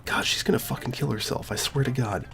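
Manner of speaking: whispered, hushed aside; hushed dread to the audience; worried whisper. Real, not acted. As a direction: breathe it under your breath, dreading. Aside fearing for Sayori.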